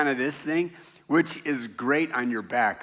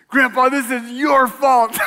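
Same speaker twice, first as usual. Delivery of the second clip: put-on high, loud, slow whining child; crying grandson; 10 semitones higher, 9 dB louder than usual. Fallen grandson blaming grandpa, high slow crying whine. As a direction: wail the blame like a hurt five-year-old. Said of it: "And he's crying".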